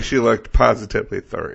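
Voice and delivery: ordinary talk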